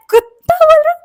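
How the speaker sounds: high, loud incredulous echo; shocked disbelief. Retold or imitated. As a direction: squeal it back in disbelief.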